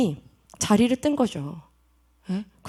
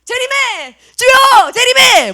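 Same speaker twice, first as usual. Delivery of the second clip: loud, high plea to the Lord; crying out in prayer; 9 semitones higher, 8 dB louder, much slower than usual. Acted. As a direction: cry out as a persecuted believer. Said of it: "뭐라고 했을까?".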